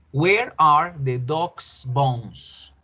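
slow, clear "dog's" as /doks/; singular possessive. Shown as a demonstration. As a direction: spell out "dog's bones" slowly with a plain s. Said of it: "¿Cómo pronunciaría yo esa primera oración?".

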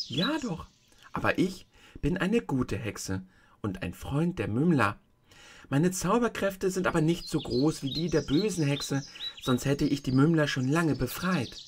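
a hint of a kindly tiny old woman; old witch of the North. The tiny old woman shows Dorothy the feet, gently voiced.

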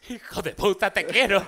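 high, loud mock insult of love; relieved outburst. Friend yells at the not-dead guy in relief, high and loud. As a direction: yell at him in relief.

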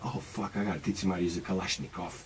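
sudden panicked thought, whispered. Retold or imitated. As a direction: rush it out in a whisper to yourself. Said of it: "all of a sudden I thought".

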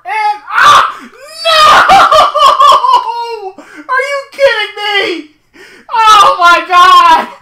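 real high, very loud wail of disbelief; anguished shout. Real, not acted. Gamer reacting to a sudden wreck, anguished shouting. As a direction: drag the words out in a loud, high howl.

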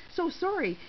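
ordinary delivery, nothing marked

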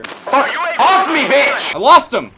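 full-throated angry gamer scream; furious yelling. Real, not acted. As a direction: yell it high and loud, really furious at the enemy. Raging at enemy in match.

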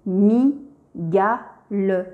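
slow, split into mi, ga, le; each syllable of "mi-ga-le" said apart, slowly. Shown as a demonstration. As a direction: sound out "mi-ga-le" slowly for a child. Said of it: "Je lis".

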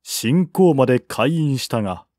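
ordinary delivery, nothing marked

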